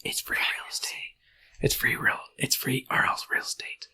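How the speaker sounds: hushed, voiceless whisper; soft, teasing whisper; whispered meme quote. Acted. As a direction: whisper it like a secret offer.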